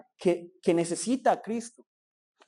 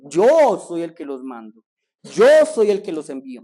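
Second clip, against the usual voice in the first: forceful preaching; 10 dB louder than usual. Acted. Preacher stresses Jesus' words.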